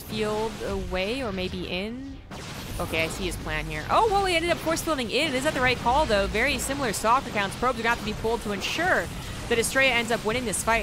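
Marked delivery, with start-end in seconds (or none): 3.9-10.8 higher, louder live battle commentary; excited play-by-play. Real, not acted. Caster reacting to a surprise force field, excited. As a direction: call the fight as it suddenly kicks off.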